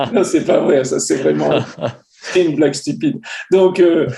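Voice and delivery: talking through real laughter after a joke; laughing. Real, not acted. Laughing at his own joke.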